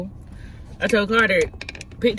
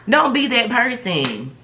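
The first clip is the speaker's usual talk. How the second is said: slow emphatic; 6 semitones higher, slower than usual. Shown as a demonstration. Preachy advice to viewers.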